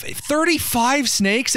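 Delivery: high, slow disbelieving question; incredulous. Real, not acted. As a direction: stretch it out in disbelief. Incredulous at snake count.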